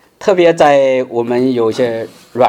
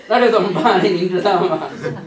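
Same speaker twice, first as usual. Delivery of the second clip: laughing; 3 semitones higher than usual. Real, not acted. Laughs at failing to pray.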